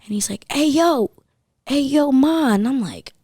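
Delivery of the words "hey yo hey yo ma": high, loud mock catcaller call; calling out like a street creep; imitated catcaller. Imitating catcaller yelling at her.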